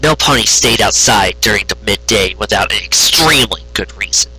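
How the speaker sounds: ordinary delivery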